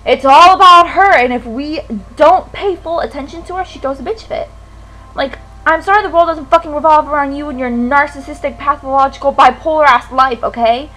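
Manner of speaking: loud, heated venting, really fed up; angry rant. Real, not acted. Venting about a friend who needs all the attention, angry. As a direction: let rip with real, rising indignation.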